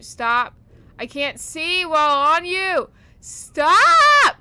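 rising panic into a scream; panicked shout. Real, not acted. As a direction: yell stop, building to a shriek. Being grabbed by enemy, panicking.

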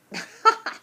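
a hearty breathy laugh for the lion. Acted. Lion laughs at the bee's promise, hearty laugh. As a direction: laugh out big like a lion amused by a bee. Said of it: "And the lion laughed".

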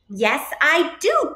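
high, drawn-out protest; crying out. A narrator's reading. Goose answers Duck's scolding, a mild high cry. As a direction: protest it high, not truly shouting. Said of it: "Goose cried".

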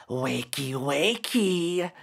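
soft teasing sing-song call, rising on the second; demon intoning. Acted. Demon waking Derek from his nightmare, teasing intonation. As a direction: croon him awake like a mocking demon. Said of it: "intoned the demon".

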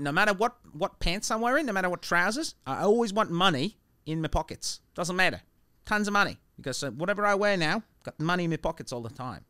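put-on Australian joke-telling voice; bad Australian accent. Comic telling a bartender street joke, put-on Australian accent. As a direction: tell it in a loose Australian accent. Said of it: "it has to be done in an Australian accent".

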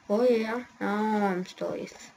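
ordinary talk, nothing marked

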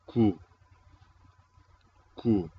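"ku" loud and isolated, falling; teacher modelling "ku" as one firm syllable; model pronunciation. Shown as a demonstration. (pronunciar "ku" como modelo, fuerte y aislado)

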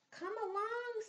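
little mouse calling friends; squeaky mouse. Acted. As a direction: call out like a small mouse. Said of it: "says Mouse".

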